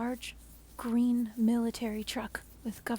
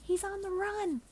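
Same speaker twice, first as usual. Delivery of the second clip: much higher, sharp alarmed call; alarmed yell; 11 semitones higher than usual. Acted. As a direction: shout the warning high. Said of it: "The hazmat woman yells".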